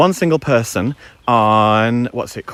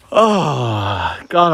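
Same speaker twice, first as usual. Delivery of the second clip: ordinary delivery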